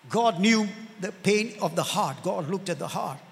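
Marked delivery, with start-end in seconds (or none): none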